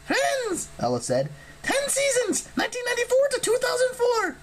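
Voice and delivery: very high bird-woman recital; high jittery harpy. A narrator's reading. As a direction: blurt it like a twitchy harpy. Ella answers Percy.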